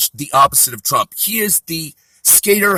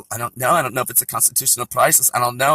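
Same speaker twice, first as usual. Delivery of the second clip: soft, lower, vocal-fry "I don't know" with upspeak; mock a ditzy TV pundit; Valley Girl; 4 semitones lower, 4 dB quieter, faster than usual. Comic mocking a Valley Girl pundit on TV, softer. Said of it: "they have a Valley Girl on there".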